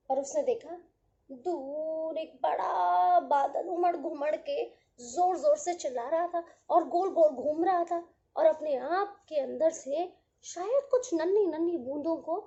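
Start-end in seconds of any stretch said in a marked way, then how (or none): none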